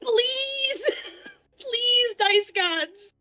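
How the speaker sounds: high, half-laughing plea; desperate pleading. Real, not acted. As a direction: beg the dice gods, laughing. Begging for a good roll.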